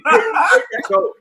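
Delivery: a shrill, slow, high yell of take that goat; cracking shout. Acted. Shouting at the other man.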